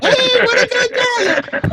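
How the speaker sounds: very high, loud, drawn-out dog praise; squealing happy trainer demo; really happy. Shown as a demonstration. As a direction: gush over the dog at full, over-the-top joy. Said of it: "You gotta get happy".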